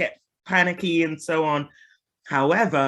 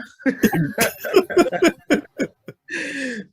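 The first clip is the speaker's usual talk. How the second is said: laugh; 11 semitones higher, 4 dB louder than usual. Real, not acted. Laughs after own accent joke.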